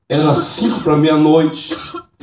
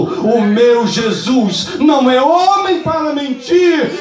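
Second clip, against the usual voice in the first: preaching shout; 12 semitones higher than usual. Real, not acted. Preacher proclaiming to the church he'll come back, shouting. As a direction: build it up and proclaim it.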